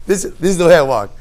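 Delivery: laughing mock stubbornness. Preacher retelling a neighbour's refusal to share the shortcut, laughing mock repeat. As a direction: echo the neighbour with an amused chuckle.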